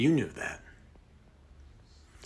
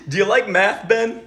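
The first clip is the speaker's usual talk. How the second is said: higher and louder, faux-friendly; sudden loud mock cheer; 9 semitones higher, 9 dB louder than usual. Interrogator springs a fake-cheerful question on Ben. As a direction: burst in with fake enthusiasm.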